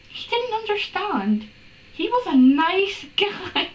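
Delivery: high, incredulous mock plea; mock pleading. Reader mocking the fanfiction hero's hurt, high pleading. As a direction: pitch it high, stretch the end into a laugh.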